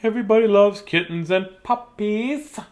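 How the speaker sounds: ordinary delivery, nothing marked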